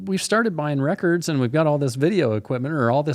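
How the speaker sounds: high, eager mock voice of a newbie; excited naive voice. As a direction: play it wide-eyed and giddy. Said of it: "it was just like a".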